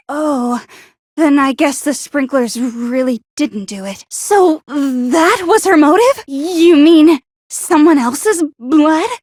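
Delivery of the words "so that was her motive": usual speaking voice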